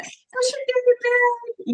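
very high, drawn-out cooing; lovey-dovey husband. Retold or imitated. Husband alone on the phone to his wife, slow exaggerated sweet cooing. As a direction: stretch it out in a hammed-up falsetto coo. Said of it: "this is how I knew he was alone".

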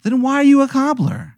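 high-pitched exasperated question; hammed-up high-voiced protest. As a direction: squeal it in disbelief at his excuse. Exasperated player challenges the cobbler.

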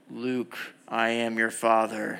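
slow, drawn-out, low villain voice; famous film line, stretched out ominously; Darth Vader. Acted. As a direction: intone it slowly and low, like a movie villain. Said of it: "my Darth Vader voice".

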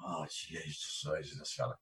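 very low, very soft aside; hushed awe. Retold or imitated. Boxer sizing up the Cuban before the bout, hushed awe. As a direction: mutter it under your breath, daunted. Retelling first sight of his opponent.

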